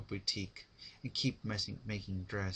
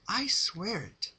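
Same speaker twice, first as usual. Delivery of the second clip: high, slow, solemn pony vow; Pinkie swearing; 12 semitones higher, 6 dB louder, slower than usual. Acted. Pinkie vows to the dying Rarity, slow and solemn. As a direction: promise it gravely. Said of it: "said Pinkie Pie".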